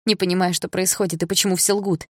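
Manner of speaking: ordinary delivery, nothing marked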